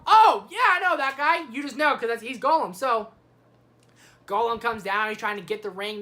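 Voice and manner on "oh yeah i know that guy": loud, high burst of recognition; sudden recognition. Acted. Viewer hearing the name Gollum, loud delighted recognition. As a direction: act as if it suddenly clicks.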